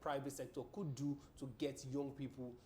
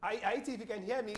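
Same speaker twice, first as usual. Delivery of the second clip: calling out loudly; 6 semitones higher, 5 dB louder than usual. Real, not acted. Calling out to faint phone guest.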